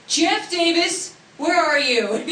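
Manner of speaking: high, loud, slow call across a room; retold yell. Co-star calling to her dad in the audience, mock yelling. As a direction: holler for someone at the very back. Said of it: "he yells to the top".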